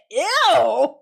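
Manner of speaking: disgusted little ew; grossed-out ew. Real, not acted. Reacting to the couple's hug with disgust. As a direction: recoil from it. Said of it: "I said".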